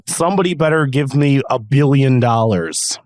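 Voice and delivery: normal talking voice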